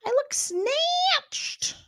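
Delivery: very high, slow preening falsetto; vain persona. Retold or imitated. Host mocking Morgan preening over her looks. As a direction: purr it like a diva admiring herself. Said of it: "She's like".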